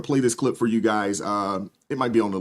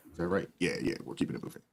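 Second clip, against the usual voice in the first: hushed aside; 5 semitones lower, 11 dB quieter, faster than usual. Real, not acted. Aside to co-host, off mic.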